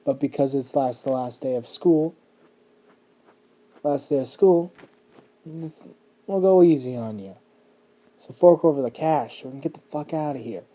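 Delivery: loud schoolyard bully demanding cash; bully shakedown. Acted. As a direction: throw your weight around, loud and menacing. Bully demands money from a kid.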